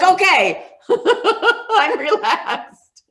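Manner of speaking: high, laughing drawn-out reply; laughing mock compliance. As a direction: giggle through a slow fake obedience. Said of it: "I'm like".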